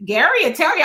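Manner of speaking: usual speaking voice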